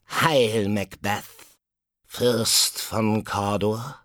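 high, slow croak of a hag; croaking witch. A narrator's reading. Second witch croaking a greeting to Macbeth. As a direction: croak out the prophecy. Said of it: "Die zweite Hexe krächzte".

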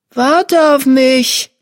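high, drawn-out kitten plea; kitten mewing. A narrator's reading. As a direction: plead like a lost kitten calling its mother. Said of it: "maunzte er".

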